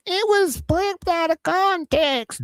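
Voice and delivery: high-pitched, drawn-out crybaby mockery; mock whining. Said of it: "You need to stop crying about".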